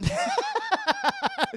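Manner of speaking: high, loud mock shriek; waking scream. Imitating her waking from the dream, high shriek.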